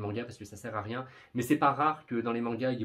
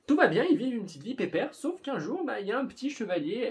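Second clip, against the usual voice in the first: excited storytelling; 10 semitones higher, 5 dB louder than usual. Real, not acted. Lively plot telling.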